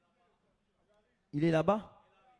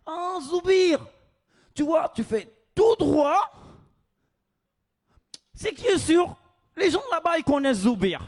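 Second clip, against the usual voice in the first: excited Moroccan local; 5 semitones higher, 8 dB louder than usual. Retold or imitated. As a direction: greet the stranger with big enthusiasm.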